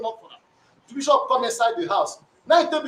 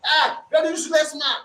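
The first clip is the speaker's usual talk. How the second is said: imitating a furious shout; retold shout; 4 semitones higher than usual. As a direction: bark it out like the angry wife, half acted. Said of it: "he begin to shout".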